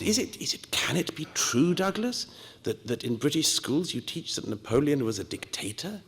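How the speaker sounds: put-on high, astonished disbelief without any accent; incredulous admiral. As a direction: play the stunned French admiral, no accent. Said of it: "I won't do my French accent".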